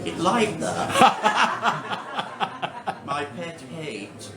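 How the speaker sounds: deadpan verse recital with room for laughs; comic poetry reading. Acted. Poet confiding a pet hate to a laughing audience, comic recital. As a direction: read the poem in measured phrases, building then dropping for the punchline. Reads comic poem aloud.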